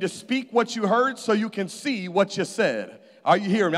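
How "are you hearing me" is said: usual speaking voice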